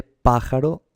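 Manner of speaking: pájaro said slowly as PÁ-ja-ro, first syllable high; stress on PA. Shown as a demonstration. Teacher testing stresses aloud, pájaro slowly stressed on first PÁ. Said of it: "Sí, se dice pájaro".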